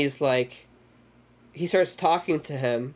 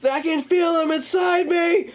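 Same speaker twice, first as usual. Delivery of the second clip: high-pitched mock horror wail; panicked scream; 14 semitones higher than usual. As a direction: imitate a man shrieking in terror. Retold horror scene, man panicking.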